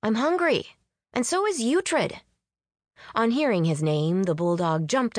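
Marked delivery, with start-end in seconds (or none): 0.0-2.2 high-pitched hungry complaint; grumbling. A narrator's reading. Hungry friend on the couch with the bulldog, grumbling. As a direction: grumble for food in a raised pitch. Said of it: "Faye grumbled from the couch".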